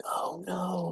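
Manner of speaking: a hushed, worried "oh no" under the breath; quiet inner panic, barely voiced; whispered oh no. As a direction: whisper it like a sudden sinking feeling. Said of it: "I'm like".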